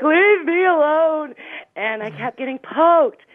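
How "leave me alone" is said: high, slow, wailing leave me alone; high drawn-out crying whine; crying plea. Retold or imitated. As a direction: wail it like a sobbing woman under the covers. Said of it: "And I'm like".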